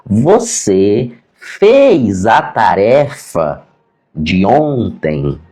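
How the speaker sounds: very slow, word-by-word imitation; over-articulated. Teacher asks deaf pupil about homework, slow exaggerated articulation. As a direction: stretch every syllable, as if for lip-reading. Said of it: "bem articulado assim".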